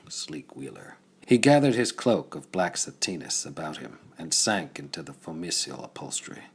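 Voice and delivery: ordinary delivery, nothing marked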